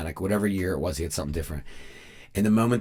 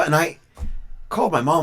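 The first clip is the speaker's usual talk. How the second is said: ordinary delivery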